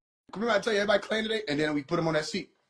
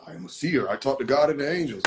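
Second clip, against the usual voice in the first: lower, solemn mock prophet; mock seer voice; 5 semitones lower than usual. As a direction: play the pompous seer lightly. Mocking seer again, low.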